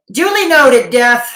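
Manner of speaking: hammed-up formal nod; mock pompous acknowledgement. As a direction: announce it like a butler, loud and high.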